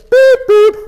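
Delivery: high toy-machine beeping; mock electronic beeps; high electronic beep imitation. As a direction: beep like a Simon game. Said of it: "So from our perspective".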